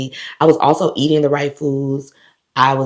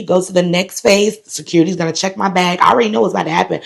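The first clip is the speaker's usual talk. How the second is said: usual speaking voice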